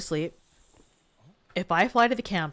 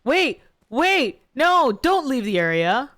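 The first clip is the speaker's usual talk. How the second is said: loud, high panicked calls; shouting after it; 4 semitones higher, 5 dB louder, slower than usual. Real, not acted. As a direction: yell at the fleeing monster to stay. Monster flees, calling out.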